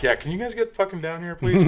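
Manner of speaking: pleading into the phone, laughing; half-laughing, urgent; urgent laughing plea. Retold or imitated. Bouncer pleading with 911 dispatcher, laughing urgently.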